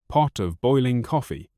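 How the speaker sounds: normal talking voice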